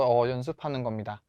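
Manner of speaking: ordinary delivery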